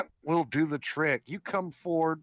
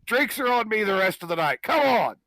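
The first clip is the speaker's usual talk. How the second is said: loud, high, hearty invitation; boisterous big-hearted drinks offer; hearty brawler; 5 semitones higher, 5 dB louder than usual. Acted. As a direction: bellow it like a victorious fighter buying the round. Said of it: "he goes".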